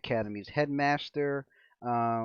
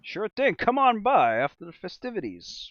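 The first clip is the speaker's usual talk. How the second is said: higher, louder boisterous merchant; hearty shopkeeper; 8 semitones higher, 6 dB louder than usual. Acted. Shopkeeper to customer asking for harrow decks, loud hearty. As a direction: burst out like a jolly storekeeper.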